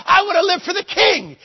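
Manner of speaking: loud, high fervent preaching shout; full-force preaching. Acted. Preacher shouting to his congregation at full force. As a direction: preach it out at the top of the voice.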